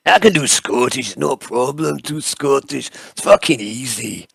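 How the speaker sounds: broad mock-Scottish; Scottish accent. Shown as a demonstration. Game master warming up for the interrogator, Scottish accent. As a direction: show off a put-on Scottish accent. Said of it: "now I need to change accent again".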